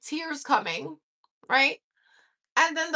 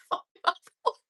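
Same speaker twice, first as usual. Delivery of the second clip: ordinary talk, nothing marked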